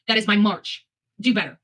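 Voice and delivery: ordinary talk, nothing marked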